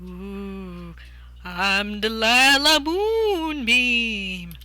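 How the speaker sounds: slow drawn-out wailing ghost; spooky ghost voice. Acted. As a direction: wail it like a ghost. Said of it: "say it like this".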